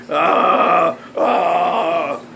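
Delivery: long drawn-out mock wail; hammed-up groaning "oh"; fake panicked moan. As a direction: wail as if in a pretend flashback. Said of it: "I'm having an anxiety panic attack".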